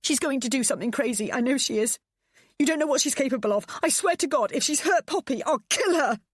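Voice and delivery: high, loud, fast frantic ranting; hysterical threat. A narrator's reading. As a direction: rant in panic and rage.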